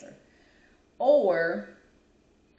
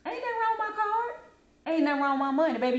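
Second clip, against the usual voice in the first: much higher, indignant sass; 7 semitones higher, faster than usual. Retold or imitated. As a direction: act offended at the register. Indignant customer voice.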